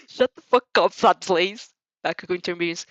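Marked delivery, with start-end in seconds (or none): 0.1-1.7 loud snarling shout; angry yell. Acted. Bakugo yelling at the teasing classmates, loud and angry. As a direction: bark at him to shut up.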